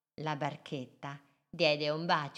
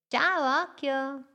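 much higher, bright greeting; little boat says hi; cheerful hello; 8 semitones higher, 4 dB louder, slower than usual. Acted. Little boat greets the big eye, high and bright.

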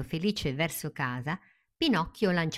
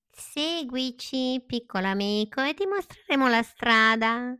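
sly duo in chorus; 8 semitones higher than usual. Acted. Cat and fox leading Pinocchio away, high and coaxing. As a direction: beckon him sweetly. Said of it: "fecero in coro il gatto e la volpe".

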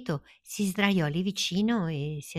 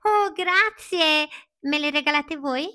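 enthusiastic; 12 semitones higher, 6 dB louder than usual. Acted. Pinocchio thrilled by the cat's promise, high and eager. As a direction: gush with innocent joy.

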